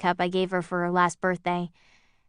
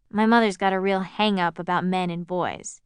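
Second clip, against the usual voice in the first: plain reading voice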